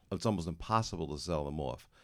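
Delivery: ordinary talk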